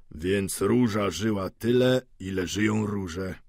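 slow, measured verse; deliberate, drawn-out lines of poetry; poem recitation. A narrator's reading. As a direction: recite it unhurriedly, with weight. Older man recites verse.

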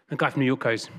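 ordinary delivery

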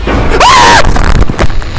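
very high, loud panicked shriek; terrified scream. Real, not acted. Player jump-scared by Slender, terrified scream. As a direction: scream in sheer fright.

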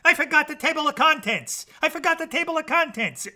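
flustered narrator crying out; frantic cry. A narrator's reading. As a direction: blurt it out in alarm. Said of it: "cried Jack the narrator".

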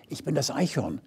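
very soft, breathy-free hush; hushed whisper. A narrator's reading. Squirrel introduces itself from the trunk, softly whispered. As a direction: squirrel whispers its name to the star. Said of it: "wisperte da eine weitere Stimme".

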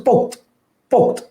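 পোক্ত said pokt, closed on t; পোক্ত as one syllable, pokt; no final vowel. Shown as a demonstration. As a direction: end পোক্ত right on the t, no o. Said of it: "এর উচ্চারণ হচ্ছে".

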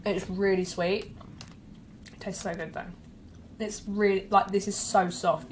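talking between bites of bun; muffled by food; mouth full, chewing. Real, not acted. Seen 1: hand covers chewing mouth, then bites bun again mid-sentence.

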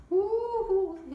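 high, loud joyful woo-hoo; gleeful storybook whoop; excited whoop. Acted. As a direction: cheer as if flying up. Koala soaring into the air.

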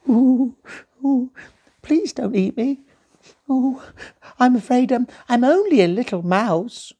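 very high, squeaky frightened mouse voice; scared little mouse. Acted. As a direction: plead in a tiny trembling squeak. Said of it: "The little mouse shivered".

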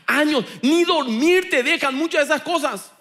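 full-force preaching. Acted. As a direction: preach it with fire to the congregation.

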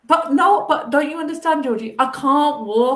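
plead, exasperated; insisting to the therapist, frantic; desperate pleading. Retold or imitated.

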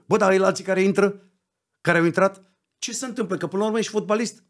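high, loud, fast tirade; retold angry rant. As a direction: hammer him with the question. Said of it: "am vorbit cu Pintili".

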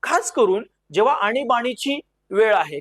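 ordinary talk, nothing marked

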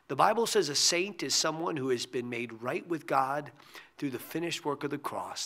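usual speaking voice

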